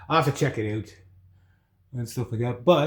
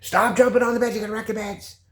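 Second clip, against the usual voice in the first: scolding grandmother; 9 semitones higher than usual. Retold or imitated. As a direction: scold the kids like grandma did. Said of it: "my grandmother here".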